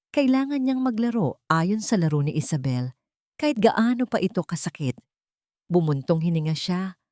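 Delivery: normal talking voice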